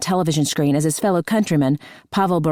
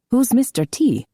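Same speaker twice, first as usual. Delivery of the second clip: put-on French-Canadian accent; 7 semitones higher than usual. A narrator's reading. Claude asking, French-Canadian voice.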